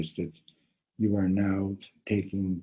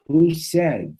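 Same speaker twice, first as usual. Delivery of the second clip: high, loud acted retort; challenging; 9 semitones higher, 9 dB louder than usual. Drinker demands the rabbi's source, challenging. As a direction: throw the challenge back.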